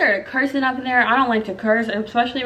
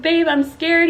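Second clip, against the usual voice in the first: higher pleading voice, retelling fear; scared, near tears; 6 semitones higher, slower than usual. Retold or imitated. Mother in labor turning to her partner, scared and near tears. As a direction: plead to your partner, close to crying. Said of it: "I'm literally about to cry right now".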